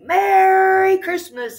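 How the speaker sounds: very high, slow joyful call; excited cry. A narrator's reading. Turkey popping out for Santa, very high and joyful. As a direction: burst out of the box with joy. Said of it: "he cried".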